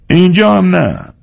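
high-pitched, lightly amused refusal; amused Tatar man. A narrator's reading. Tatar man turns down the room seeker, mildly amused. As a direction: turn him down in a high character voice, mildly amused. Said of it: "تاتار باز خندید و گفت".

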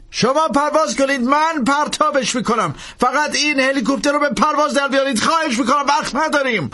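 high, pressing plea, slowing on the key words; desperate Langdon pleading. A narrator's reading. Langdon begging the priest to take off, high desperate plea. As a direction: beg him to just fly.

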